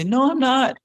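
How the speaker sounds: high-pitched mock protest; indignant denial. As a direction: deny the accusation, pitch jumping up. Acted denial in a dispute.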